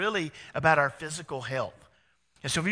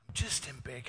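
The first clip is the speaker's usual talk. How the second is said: ordinary talk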